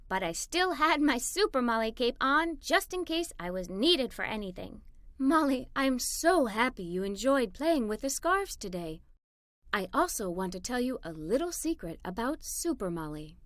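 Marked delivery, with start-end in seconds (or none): 5.2-13.4 softer, lower voice of a loving mother; a mother's soft bedtime words to her daughter; tender mother. A narrator's reading. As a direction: reassure her gently at bedtime. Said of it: "my mother said as she kissed me".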